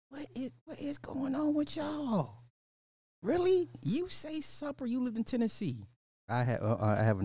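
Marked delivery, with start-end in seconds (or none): 0.1-5.9 exaggerated disbelief. Real, not acted. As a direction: act stunned by the chat. Disbelief at viewers saying supper.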